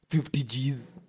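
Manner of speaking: normal talking voice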